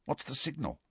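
muttered. A narrator's reading. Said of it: "muttered Harry".

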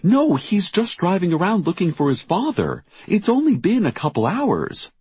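high, urgent denial; panicked protest. A narrator's reading. Mother denying news her son is missing, panicked. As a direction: protest it anxiously, pitch well up.

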